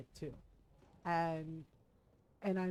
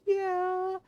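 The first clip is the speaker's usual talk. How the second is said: sniffling; 9 semitones higher, 7 dB louder than usual. Retold or imitated. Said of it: "I'm sniffling".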